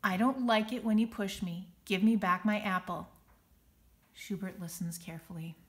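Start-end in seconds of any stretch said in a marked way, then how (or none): 0.0-3.1 louder, firm and steady; calm firm teacher voice; slow and firm. Acted. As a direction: model the big voice. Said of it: "She speaks slowly and firmly".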